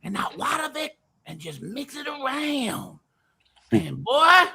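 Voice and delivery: high, hammed-up country pitch; excited put-on country voice, pitched high. Telling Vic how to mix it, high country voice. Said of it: "I'll do it in the country voice now".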